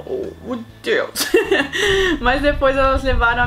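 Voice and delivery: real laughter spilling into the line; cracking up while reading it out; laughing. Real, not acted. Streamer laughing at the boy's silly answer.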